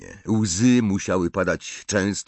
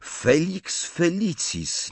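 high, very slow, drawn-out words; pondering; 11 semitones higher, much slower than usual. A narrator's reading. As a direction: stretch the name out while thinking. Harry zamyślony nad eliksirem. (w zamyśleniu, wysoko i przeciągle)

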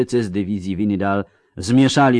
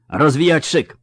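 higher-pitched, firm command to officers; military order; 7 semitones higher, 5 dB louder than usual. A narrator's reading. General ordering his commanders to deploy, commanding. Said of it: "rozkazał dowódcom".

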